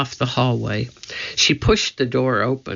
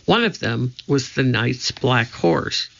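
plain reading voice

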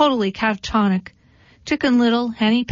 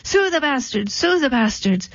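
high, frantic alarm call; high barnyard panic, calling to everyone; panicked cry; 7 semitones higher than usual. Acted. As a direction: shriek it out like a terrified hen. Birds panicking, running down the road.

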